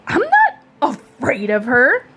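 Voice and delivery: put-on boy denies fearing a girl; defensive protest. A narrator's reading. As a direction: insist you're not scared.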